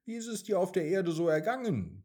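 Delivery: a light, quick motherly question; curious cloud. A narrator's reading. Cloud asks the returning raindrop, quick and curious.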